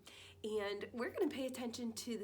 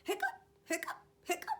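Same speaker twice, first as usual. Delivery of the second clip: high hiccup fading to a small hic; 9 semitones higher, much faster than usual. Acted. Clifford hiccups at breakfast, high then fading. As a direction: hiccups that shrink away.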